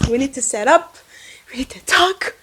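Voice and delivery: urgent, hushed whisper; dramatic whisper. Pretend. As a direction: whisper it like a grave confidence. Mock-serious whispered confiding to viewers.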